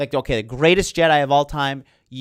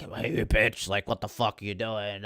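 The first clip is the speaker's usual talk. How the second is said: Kylo quote; 5 semitones lower, 7 dB quieter than usual. Retold or imitated. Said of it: "and he's like".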